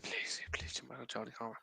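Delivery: hushed, begging whisper; whispered plea. Retold or imitated. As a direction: whisper it like a prayer. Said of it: "I was like".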